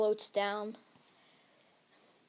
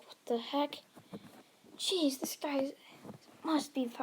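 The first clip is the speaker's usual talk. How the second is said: higher-pitched, mildly stunned reaction; acted awe from bystanders; amazed onlookers; 4 semitones higher than usual.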